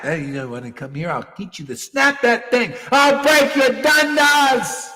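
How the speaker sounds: hammed-up threat growing to a yell; mock furious threat. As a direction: threaten them, rising to a roar. Angry guru threatens.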